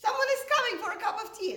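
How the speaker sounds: high, loud, fast retold announcement; excited announcement. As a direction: blurt it out high and quick. Retold announcement to startled mother.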